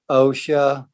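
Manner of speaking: normal talking voice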